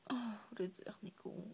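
usual speaking voice

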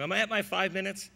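normal talking voice

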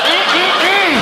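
high, loud approving mm-mm; a high, loud, lecherous mm; lustful hum. Retold or imitated. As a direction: savor it with a hum. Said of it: "It's like".

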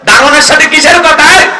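high, loud reproachful question; acted scolding. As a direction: scold a child as a snobbish parent would. Acts out snobbish parent scolding child.

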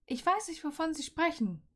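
student snapping at her professor; biting snap. Acted. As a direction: snap back at him, stung. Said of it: "fauchte sie bissig".

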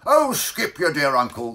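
loud, high exasperated interruption; angry exclamation. Acted. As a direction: burst in crossly over the story. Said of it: "as he angrily tingled his bell".

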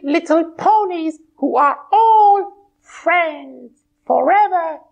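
high bright pony voice; a cheerful high puppet pony. Acted. Twilight puppet answering host, high pony voice.

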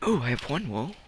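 ordinary talk

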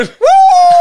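high, loud shrieking laugh. Real, not acted. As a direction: burst out in a screaming laugh. Golf story, big laugh.

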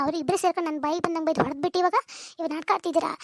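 usual speaking voice

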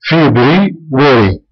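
فبراير said very slowly, syllable by syllable; slow, careful teacher model of فبراير; slow teaching demo. Shown as a demonstration. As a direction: spell out فبراير slowly for learners. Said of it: "آخر كلمة هي كلمة واري".

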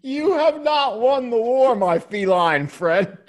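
high-pitched, grandiose mock threat; drawn-out theatrical challenge at the end; mock-dramatic defiance. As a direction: declare war on the cat, hamming it up. Mock declaration of war to cat.

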